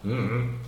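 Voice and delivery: closed-mouth hummed shrug; wordless "dunno" hum; mumbled "I don't know". Shown as a demonstration. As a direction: hum the tune of "I don't know" without words. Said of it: "eu nem falo, "I don't know".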